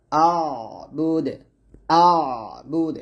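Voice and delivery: "আ'বুদ" slow, glottal start tightening mid-throat; hamza into ayn. Shown as a demonstration. Teacher showing the throat shift, "আ'বুদ" slow with pressed ayn. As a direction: draw out "আ'বুদ", squeezing the ayn before a long বুদ. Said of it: "এরকম".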